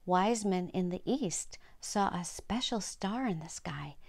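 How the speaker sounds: plain reading voice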